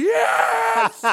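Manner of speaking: high exultant cry; the late comic cheers as he makes the show; triumphant yes. Retold or imitated. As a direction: shout it like a win. Triumphant when host announces him.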